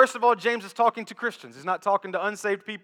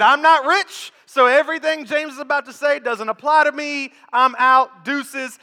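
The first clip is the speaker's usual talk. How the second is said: louder, higher mock brush-off; dismissive listener; 5 semitones higher, 7 dB louder than usual. Pastor voicing a congregant tuning out, mock dismissive. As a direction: wave it off, "I'm out, deuces". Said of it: "You said".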